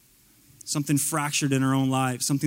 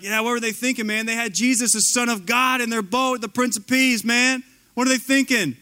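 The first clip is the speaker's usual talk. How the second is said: higher-pitched, incredulous onlooker voice; incredulous mockery; 6 semitones higher than usual. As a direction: scoff at their foolishness in a higher voice. Mocks disciples as incredulous onlookers.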